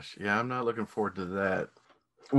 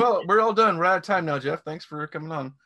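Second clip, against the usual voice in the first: higher, brisk mock host voice; mock host sign-off; 7 semitones higher than usual. Host retelling how he ended an interview, mock sign-off. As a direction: imitate a host cutting an interview short.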